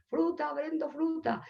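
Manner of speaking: high, soft singsong street call; fruit seller's cry. Acted. Fruit seller passing with his truck, singsong hawking. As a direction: call out your wares from afar, high-pitched. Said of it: "oye pasar al frutero".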